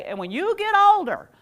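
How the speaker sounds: high, loud mock parent lecture; lecturing parent. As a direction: nag like a parent droning on. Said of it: "your parents would say".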